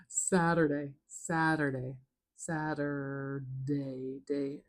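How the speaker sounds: very slow "Saturday", split into syllables; "Saturday" drawn out to show each sound. Shown as a demonstration. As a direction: stretch "Saturday" out, then repeat "day". Drawing out the syllables.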